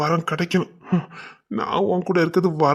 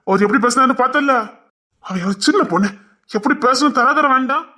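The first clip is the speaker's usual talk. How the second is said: higher, louder, heated protest; indignant complaint; 7 semitones higher, 5 dB louder than usual. Acted. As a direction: vent in outrage at how someone spoke. Brother angry at father's words.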